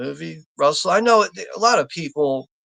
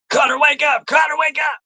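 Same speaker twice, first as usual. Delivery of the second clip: high, loud wake-up call; urgent shout; 11 semitones higher, 4 dB louder than usual. Acted. Movie character rousing Cutter as it gets hot, urgent shouting.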